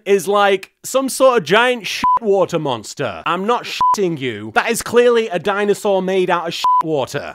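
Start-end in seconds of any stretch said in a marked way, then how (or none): none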